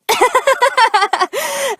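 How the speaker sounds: high, giddy burst of laughter; high embarrassed laugh. Acted. Laughing off her outburst to her date, flustered.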